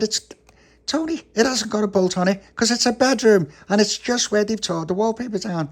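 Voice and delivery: a bit fed up, set him straight; exasperated high voice. Acted. Papa exasperated reply.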